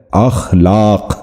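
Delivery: اخلاق slowly, with "a" first: akh-laaq; correct pronunciation. Shown as a demonstration. Teacher repeating for viewers the correct اخلاق, slow akh-laaq. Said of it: "درست تلفظ ہے".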